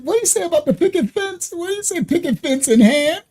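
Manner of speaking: high, squeaky incredulous question; giggling disbelief; laughing disbelief. Real, not acted. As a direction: ask while cracking up.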